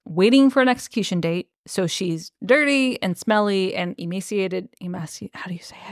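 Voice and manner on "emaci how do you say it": quick whispered check; very soft, rapid whispered question; hushed aside. Real, not acted. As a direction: whisper it fast, unsure of the word. Quick aside about a hard word.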